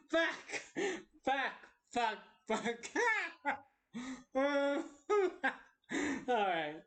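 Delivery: ordinary delivery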